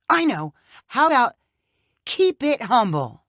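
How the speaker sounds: excited idea. Acted. Tamara hitting on her new catchphrase, excited. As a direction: announce the find like a eureka.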